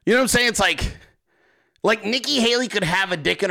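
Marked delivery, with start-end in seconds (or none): none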